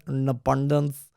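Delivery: slow, imitated Indian-accented INDEPENDENCE. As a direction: say it like an Indian tour guide. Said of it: "the way he says".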